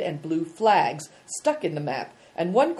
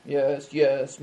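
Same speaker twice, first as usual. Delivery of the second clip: ordinary delivery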